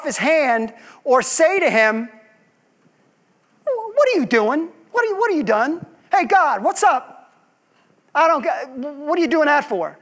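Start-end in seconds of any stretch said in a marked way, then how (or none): 3.6-10.0 high, indignant demand at God; mock challenge, pitched up and sassy; with an attitude. Preacher mimics a believer questioning God with attitude. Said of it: "And I say that with an attitude".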